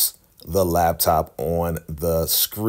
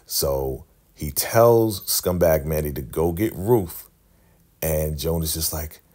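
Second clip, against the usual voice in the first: usual speaking voice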